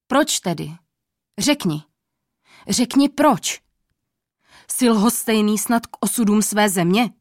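an impatient king's furious demand; angry demand. A narrator's reading. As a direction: press the old man hard. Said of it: "A hlas měl hněvivý".

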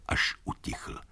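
fading to a whisper. A narrator's reading. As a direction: let it die away. Hoofbeats fade out.

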